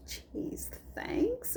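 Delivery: muttered "Jeez, thanks" under the breath, barely voiced; a sarcastic little thank-you, almost whispered; sarcastic aside. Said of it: "And I'd be like".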